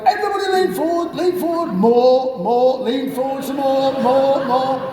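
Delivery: very high, fast urging; urging everyone. Retold or imitated. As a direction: egg the passengers on. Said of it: "he's going like".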